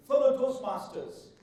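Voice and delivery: usual speaking voice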